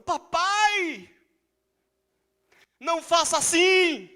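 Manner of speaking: put-on high, loud child's cry to her father; little girl's plea. Preacher voicing the mute daughter crying out, high and loud. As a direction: call out like a small girl begging.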